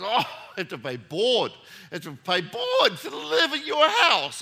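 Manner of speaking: high, loud mock protest building to a shout; hammed-up incredulous wail; outraged son. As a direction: imitate a teenager's indignant disbelief, voice shooting up. Son protests paying board.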